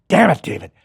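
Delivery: high, loud Freddy snarl; the Freddy voice born in the mirror. Shown as a demonstration. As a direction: curse like a monster who just found his voice. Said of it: "the voice goes with that guy".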